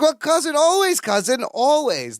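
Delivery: high, playful mock Balki voice; lifting the pitch to mimic cousin Balki; Balki impression. Balki voiced in episode recap.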